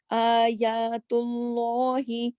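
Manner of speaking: آیات اللہ slowly, long vowels held; slow Qur'anic recitation. Shown as a demonstration. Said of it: "کھڑا زبر ہے".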